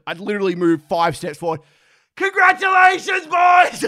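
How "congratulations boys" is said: higher, louder call to the whole team; a runner shouting congratulations to the under-sixteens; cheery shout. Retold or imitated.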